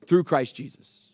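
ordinary talk, nothing marked

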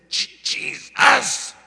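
strained, high shout of the name; choking cry for help; desperate cry. Retold or imitated. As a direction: force the name out with the last breath. Gasping the name while being choked.

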